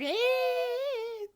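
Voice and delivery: very high, sustained imitated squeal; piercingly high mocking "eee"; mock fangirl squeal. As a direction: squeal like an overexcited teenage fan. Imitating screaming teenage boy-band fans.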